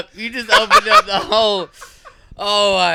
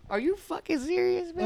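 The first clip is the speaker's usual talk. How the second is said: put-on high, soft disbelieving kid; son's shocked whine; 11 semitones higher, 11 dB quieter than usual. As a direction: whine it like a betrayed child. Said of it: "You were like".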